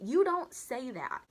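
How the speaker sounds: loud, high, drawn-out disbelief; appalled scolding. Real, not acted. Teller reacting to the pregnancy remark, appalled. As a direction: scold slowly, leaning on every word.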